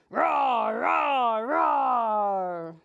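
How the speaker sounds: put-on loud, playful dinosaur roar for kids. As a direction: roar like a big cartoon dinosaur. Dinosaurs attack the kitties.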